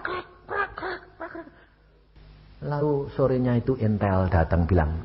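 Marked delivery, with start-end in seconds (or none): 0.0-1.5 high creaking krek noises, fading; imitated door creak. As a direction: scrape out the door sounds, dying away. (tiruan bunyi pintu berderit)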